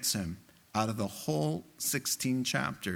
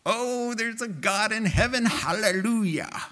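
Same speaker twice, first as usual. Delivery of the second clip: mock jubilant praise; 8 semitones higher than usual. Preacher mimicking worshippers leaving the halls, high mock praise. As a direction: play a gushing believer, overdone. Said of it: "and going".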